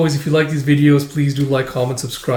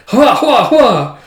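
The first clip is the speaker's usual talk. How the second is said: hammed-up laugh; 8 semitones higher, 6 dB louder, much slower than usual. Mock laugh.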